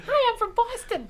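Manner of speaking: high, slow, hammed-up Boston accent; overdone mocking Boston accent. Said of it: "as good as your Boston accent".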